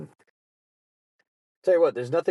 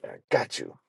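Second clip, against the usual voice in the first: much lower, sinister I got you; sinister gloat; 11 semitones lower, 3 dB quieter than usual. Retold or imitated. Prankster gloating over the caught lunch thief, low and sinister. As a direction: gloat low like a villain who won. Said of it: "just to be like".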